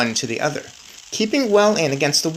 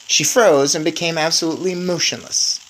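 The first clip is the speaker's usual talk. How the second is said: usual speaking voice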